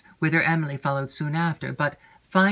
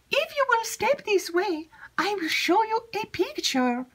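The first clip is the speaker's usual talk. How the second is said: put-on much higher, eager young maid's voice; chattering maid Annette. Maid Annette eagerly seeking Emily, high excited chatter. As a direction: gush it out high and breathless with news.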